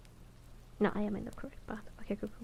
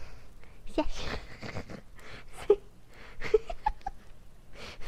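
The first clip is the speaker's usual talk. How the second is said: long, high giggling fit; helpless high laughter; laughing; 10 semitones higher than usual. Real, not acted. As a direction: lose it over a tongue-twister. Cracks up over failed word.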